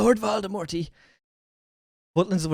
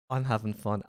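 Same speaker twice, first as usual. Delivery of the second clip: ordinary talk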